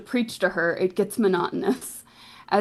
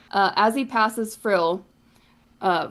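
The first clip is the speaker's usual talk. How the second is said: ordinary delivery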